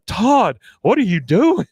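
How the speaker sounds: high, loud alarmed cry at the stage; Hank yelling at Todd. Retold or imitated. Hank yells at Todd through the skybox glass, high and loud. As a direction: shout up at the stage in dismay. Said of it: "And he goes".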